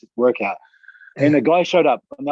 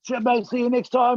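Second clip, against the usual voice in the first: high friendly farewell; 11 semitones higher than usual. Retold or imitated. Stranger calling from car window.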